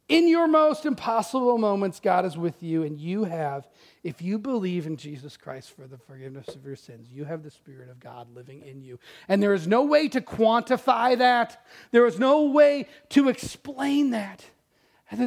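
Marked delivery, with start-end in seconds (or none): 5.0-9.0 low, soft, slowed assurance; near whisper. Real, not acted. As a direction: lean in and hush it. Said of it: "sermon sinks to near whisper".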